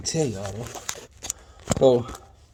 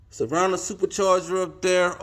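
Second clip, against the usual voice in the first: normal talking voice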